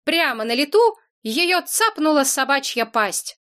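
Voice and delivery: ordinary delivery, nothing marked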